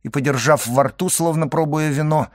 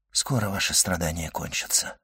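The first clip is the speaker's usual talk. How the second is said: soft, lowered voice; gentle consoling; 6 semitones lower, 8 dB quieter than usual. A narrator's reading. Doctor comforts the dying servant, gently hushed. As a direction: console him quietly.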